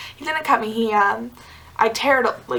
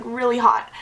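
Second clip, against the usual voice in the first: normal talking voice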